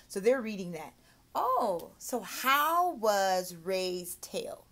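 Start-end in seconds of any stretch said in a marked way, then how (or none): 1.3-4.7 put-on very slow, stretched question to a child; slow teacher question. Shown as a demonstration. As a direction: draw out each word for a learner. Demo comprehension question.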